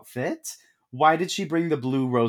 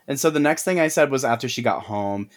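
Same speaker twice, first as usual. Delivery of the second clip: ordinary delivery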